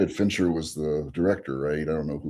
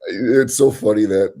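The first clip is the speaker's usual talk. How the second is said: ordinary delivery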